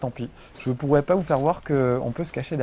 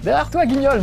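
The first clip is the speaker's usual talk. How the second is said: teammate voice; 10 semitones higher, 5 dB louder than usual. Retold or imitated. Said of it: "me souffle-t-on".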